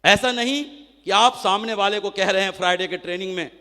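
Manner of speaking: ordinary talk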